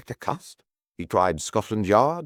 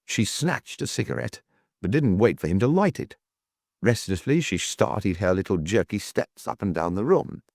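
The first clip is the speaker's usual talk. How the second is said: ordinary delivery, nothing marked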